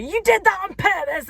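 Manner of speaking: high mock accusation; mock accusing boyfriend. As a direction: throw the accusation up high and hurt. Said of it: "And he was like".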